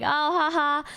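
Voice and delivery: a forced little chuckle through the words; polite fake laugh. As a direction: laugh it off politely at the rider's answer. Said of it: "I started laughing and I'm like".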